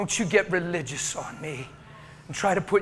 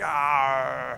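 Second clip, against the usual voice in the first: low growl from deep inside; short stirred-up growl; 8 semitones lower than usual. Real, not acted. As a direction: let a growl rumble out. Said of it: "I got a growling thing going on in me".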